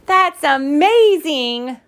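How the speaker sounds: high, stretched-out awe; slow, loud, swooping delight; gushing. Acted. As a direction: gush like adoring little sisters. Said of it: "gushed the girls".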